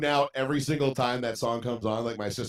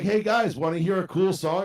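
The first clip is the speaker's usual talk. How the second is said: higher, louder imitation of himself pestering everyone; calling out; 6 semitones higher, 5 dB louder than usual. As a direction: call to the room with mock enthusiasm. Drunk, pestering sleepy family.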